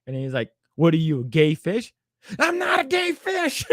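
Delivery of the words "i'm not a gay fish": high, drawn-out indignant cry; hammed-up outrage at missing the joke; pissed-off outburst. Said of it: "He gets really pissed off".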